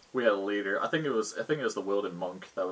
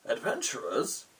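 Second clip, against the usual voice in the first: lightly voiced puzzled guard; a touch of a guard's surprise, rising at the end; odd-looking guard; 4 semitones higher, slower than usual. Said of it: "one of the guards looks at them oddly and just says".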